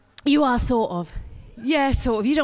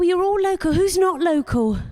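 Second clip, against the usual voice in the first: ordinary delivery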